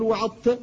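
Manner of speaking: وعظت with the ظ assimilated into the ت; merged zha. Shown as a demonstration. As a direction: show the faulty وعظت with no distinct ظ. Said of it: "يدغمها فيقول".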